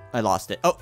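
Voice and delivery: ordinary delivery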